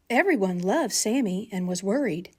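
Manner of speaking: usual speaking voice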